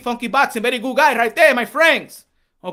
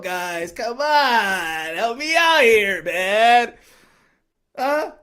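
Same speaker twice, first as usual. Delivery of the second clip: ordinary talk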